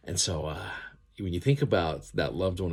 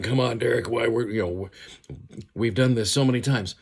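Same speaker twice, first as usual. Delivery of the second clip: louder, higher exasperated complaint; mock exasperation; 5 semitones higher, 6 dB louder than usual. The Lord imagined chiding Derek for repeat failings, mock exasperation. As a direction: play a fed-up, head-shaking reproach. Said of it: "sitting there shaking his head going".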